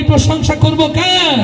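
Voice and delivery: high, slow shout to the crowd; loud slow call. Shown as a demonstration. Emcee rousing the crowd to answer, loud and slow. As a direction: belt it out slowly and high. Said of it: "এবার আওয়াজ দিয়ে বলি".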